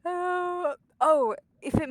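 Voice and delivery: high, loud, half-crying laugh. Real, not acted. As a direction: laugh it out in dismay. Laughing crying at game death.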